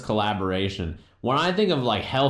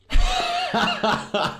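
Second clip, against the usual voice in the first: sharp, high laugh, louder; high burst of laughter; 12 semitones higher, 3 dB louder than usual. Real, not acted. Streamer laughing at Obama's Flintstones joke, high burst of laughter.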